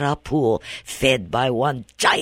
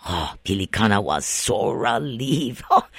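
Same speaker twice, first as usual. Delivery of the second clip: usual speaking voice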